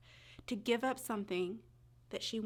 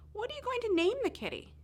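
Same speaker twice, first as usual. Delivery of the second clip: mother's question; 13 semitones higher, 5 dB louder than usual. Acted. Mommy asking on drive home.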